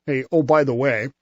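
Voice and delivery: usual speaking voice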